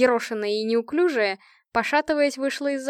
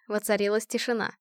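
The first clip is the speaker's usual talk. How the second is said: ordinary delivery, nothing marked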